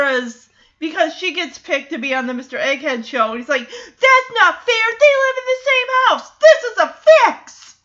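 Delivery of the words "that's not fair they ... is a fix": loud, high mock tantrum; indignant protest. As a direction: imitate a kid crying foul. Retelling Walter's outburst of protest.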